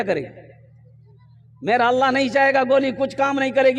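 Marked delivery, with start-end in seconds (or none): 1.6-3.8 higher, urgent preaching voice; rousing sermon delivery; fervent exhortation. Real, not acted. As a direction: urge the congregation on with conviction. Exhorts congregation with force.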